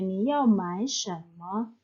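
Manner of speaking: slow, careful Mandarin model read; slow model sentence. Shown as a demonstration. Teacher reading an example sentence to students, slow and careful. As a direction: spell it out slowly for learners. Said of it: "kalau pertanyaannya".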